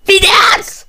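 very high screamed military command; piercing parade-ground shriek; terrifying scream. A narrator's reading. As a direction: scream the order like a man hit by a bullet. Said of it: "olyan rémületes férfi sikójjal".

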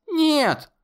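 very high, anguished no; desperate cry. A narrator's reading. Conor crying out denial to the monster.

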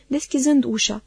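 plain reading voice

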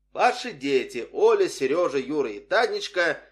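loud official list of names; mock public-address bulletin; blaring loudspeaker announcement. A narrator's reading. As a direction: call the names out across the park. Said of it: "заорал на весь парк репродуктор".

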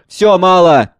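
loud, high cry of despair. Acted. Son cries out to father, despairing shout. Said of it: "С отчаянием".

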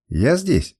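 plain reading voice

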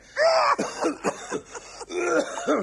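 a forced coughing fit, almost a laugh; faked cough fit. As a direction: hack and splutter to cover yourself. Eder fakes a coughing fit.

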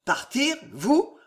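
grondement incrédule, aigu; grondé. A narrator's reading. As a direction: gronder d'indignation. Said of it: "gronda le frère de François". (Henri gronde contre le projet de Jeanne)

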